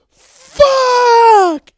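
long, high full-force scream; furious yell. Retold or imitated. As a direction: scream it out and hold it. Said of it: "and then just yelled".

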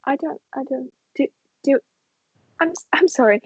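high, stumbling apology; stammering nervous apology; flustered stammer. Acted.

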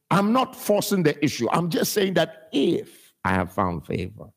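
ordinary talk, nothing marked

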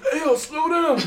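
winded sergeant. Retold or imitated. Imitating winded sergeant on run.

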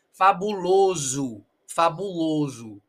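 "fabuloso" drawn out slowly, last O said as U; Portuguese final U. Shown as a demonstration. Teacher modelling Portuguese "fabuloso", final O as U. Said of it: "el primero O, fabulo, está con sonido de O".

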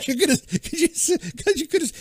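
high voice cracking with laughter; laughing. Real, not acted. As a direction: giggle through the words. Teasing about the word bra.

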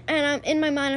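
ordinary talk

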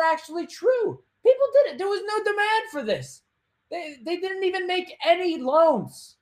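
high-pitched, emphatic disbelief; incredulous outburst. Real, not acted. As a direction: voice leaps way up in protest. Exposing the scam with incredulity.

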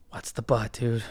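low, soft, weary aside; muttered exasperation. Retold or imitated. Passenger dreading the driver's excuse, quiet exasperation. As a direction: mutter it low under your breath. Retold weary private reaction.

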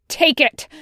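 loud, high-pitched angry outburst; snarl. A narrator's reading. Wash rounds on Tucker, snarling. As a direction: cut him off with a snarl. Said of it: "Washington snarls".

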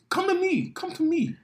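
high, light put-on voice; mocking beckoning. Co-host complaining it always comes back on him, high mocking voice. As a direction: lift it way up and keep it soft. Acting out what's expected of him.

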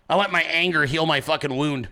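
normal talking voice

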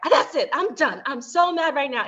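fed up with his spending, she announces she's done; mad outburst. Retold or imitated. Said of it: "he made me really mad".

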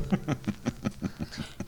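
low, soft chuckle; long, quiet knowing laugh. Acted. Intruder laughs at her demand.

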